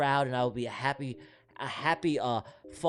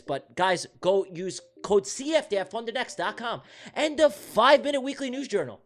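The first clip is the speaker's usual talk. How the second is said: raised, emphatic promo pitch, building to a louder finish; sponsorship voice; 4 semitones higher, 3 dB louder than usual. Shown as a demonstration. Streamer sells trading sponsors to viewers, in a hyped sponsorship voice. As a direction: sell each sponsor with big announcer energy. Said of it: "Let me put my sponsorship voice on".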